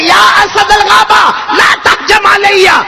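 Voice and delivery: high, loud and slow, summoning courage; a companion shouting bravely at a lion; bold call to a lion. Acted. Calling out boldly to the lion.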